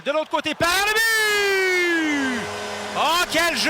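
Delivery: long slow stretched goal call. Real, not acted. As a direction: drag out the goal.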